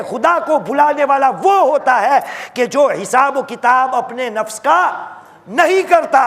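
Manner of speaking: high, insistent sermon warning; sustained high-pitched exhortation; impassioned preaching. Acted. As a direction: warn the congregation with full fervour. Preaches at full force.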